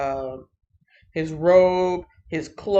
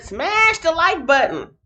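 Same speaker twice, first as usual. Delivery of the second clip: excited call out; 10 semitones higher, 6 dB louder, slower than usual. Real, not acted. Hyped call to action.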